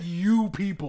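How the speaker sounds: "You" punched, "people" falling; stress on you. Retold or imitated. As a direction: hit the word "You" hard and high. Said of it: "No, no, no, he said".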